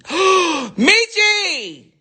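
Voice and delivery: light-bulb moment, call it out; excited gasp of realisation; sudden idea. Real, not acted. Sudden idea, gasps a name.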